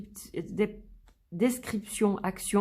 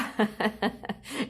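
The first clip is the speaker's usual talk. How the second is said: short real giggle at a funny card; real amused chuckle; amused laugh; 3 dB quieter than usual. Real, not acted. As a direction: laugh at the surprise. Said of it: "J'ai rigolé quand j'ai vu celle-là".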